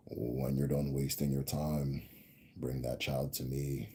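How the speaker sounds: low, slow elder's command, spoken in a lightly voiced grand uncle; a hint of a stern old grand uncle summoning the child, drawn out low; grand uncle's way of talking. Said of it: "he has this way of talking".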